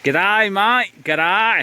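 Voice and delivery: high, hammed-up Aussie g'day; terrible Australian accent. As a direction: greet like a bad imitation Australian. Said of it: "my terrible Australian accent".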